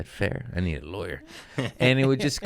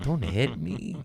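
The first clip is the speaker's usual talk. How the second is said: mock whimper; 5 semitones higher, 5 dB quieter, slower than usual. Mock pleading about gang fights.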